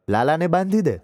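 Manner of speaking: put-on old aunt's order. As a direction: tell the girl off lightly, pointing. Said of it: "હાથ લંબાવીને કહેલું".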